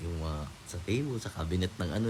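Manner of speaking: ordinary talk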